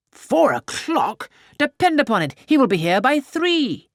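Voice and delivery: high, loud, eager interjection; eager correction. A narrator's reading. Mr Weston cuts in on his wife, eager and loud. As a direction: jump in brightly to correct her. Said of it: "Mister Weston's quick amendment".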